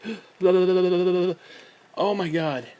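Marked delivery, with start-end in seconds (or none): 0.0-1.3 mock chattering teeth, a touch louder; imitating shivering. Sitting outside in shorts, mock chattering teeth from the cold. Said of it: "Like, I'm shivering".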